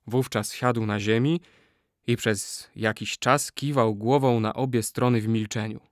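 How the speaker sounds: usual speaking voice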